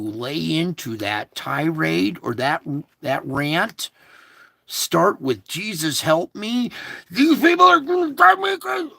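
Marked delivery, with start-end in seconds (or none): 5.5-8.9 high, loud, fast exasperated shout; exasperated yell for help; frustrated outcry. Shown as a demonstration. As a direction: blurt it out at the end of your rope. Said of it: "that's how you do it".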